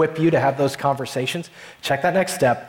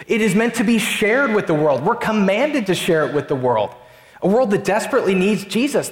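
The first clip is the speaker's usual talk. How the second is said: preaching at full force; 5 semitones higher than usual. Acted. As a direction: lift the congregation with passionate conviction. Urgent call to share faith.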